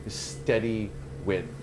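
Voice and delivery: slow, hushed vision narration; game master's scene setting. Acted. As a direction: draw them into the eerie vision slowly. GM describes the vision.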